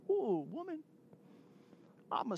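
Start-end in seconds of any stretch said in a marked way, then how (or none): 0.1-0.8 higher, teasing "Ooh, woman"; a mock-exasperated call to his wife; hammed-up husband protest; playful exclaim. Joking with his wife on stage.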